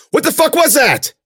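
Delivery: high, loud shocked outburst; panicked shouted question; alarmed yell. A narrator's reading. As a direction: blurt it out in fright. Shock at seeing the creature.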